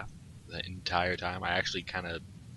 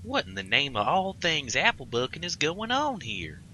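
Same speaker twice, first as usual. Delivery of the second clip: high, raised shocked country voice; shocked cousin; 8 semitones higher, 3 dB louder than usual. Acted. Rayburn walks in on the scene, lightly voiced shock. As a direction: demand to know what's going on.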